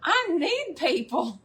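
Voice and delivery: high, drawn-out plea, laughing; hammed-up comedian's wail; imitating the comedian. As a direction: plead it slowly with a laugh. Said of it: "And she's like".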